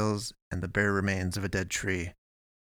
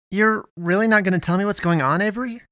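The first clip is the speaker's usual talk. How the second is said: high, louder, insistent plea; pleading; 11 semitones higher, 6 dB louder than usual. A narrator's reading. Eddie begging Avery to say what's going on, pleading. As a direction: beg for an answer, voice pitched way up. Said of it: "he pleaded".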